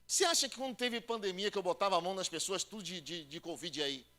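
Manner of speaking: ordinary talk, nothing marked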